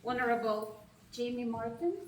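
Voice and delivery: ordinary talk, nothing marked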